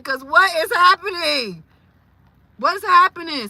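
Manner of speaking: high, loud cry of disbelief; bewildered. Real, not acted. Suspecting voodoo on Miss Cynthia, bewildered. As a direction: ask it loudly, at a loss.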